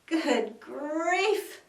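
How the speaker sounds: high, loud exasperated groan; mock groan. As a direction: moan it in disgust. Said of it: "groaned the ones".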